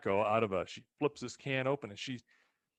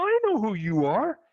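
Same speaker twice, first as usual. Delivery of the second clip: put-on old lady voice; 11 semitones higher, 7 dB louder than usual. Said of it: "And she goes".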